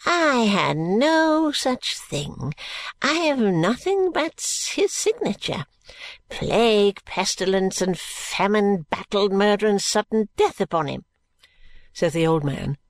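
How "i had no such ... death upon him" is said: stretched, high-swooping opening, then a rolled-out curse; indignant old man pronouncing a curse; cursing old man. A narrator's reading. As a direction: build a list of plagues into a malediction. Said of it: "making a curse out of one of his few remembrances of a prayer".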